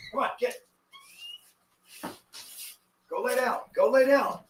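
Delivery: high firm commands to the dog; talking to a dog. Real, not acted. Ordering dog to lie down.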